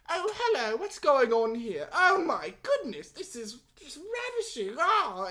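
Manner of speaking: put-on high, loud, drawn-out hammy English accent; cheesy English accent. Actor auditioning for directors, cheesy English accent. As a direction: gush slowly in an over-the-top English accent. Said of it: "do an English accent".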